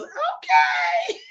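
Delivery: high sweet okay; dreamed-of agreeable child, high; obedient child. Retold or imitated. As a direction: chirp it like a good little kid. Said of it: "a child that goes".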